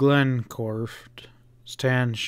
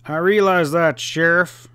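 a tart retort, voice raised and louder; tartly; 4 semitones higher, 6 dB louder than usual. A narrator's reading. North answering the sheriff tartly in anger. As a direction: bite back at him. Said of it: "he replied tartly".

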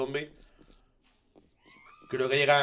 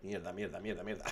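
muttered curses, much softer; cursing under breath; 5 semitones lower, 14 dB quieter than usual. Real, not acted. Horde closing in, swearing.

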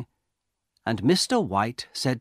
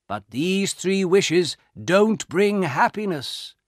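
slow, weighty warning, raised pitch; old soldier, earnest; 8 semitones higher, 5 dB louder, slower than usual. A narrator's reading. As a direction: admonish the young man slowly.

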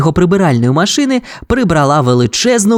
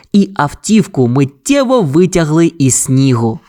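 plain reading voice

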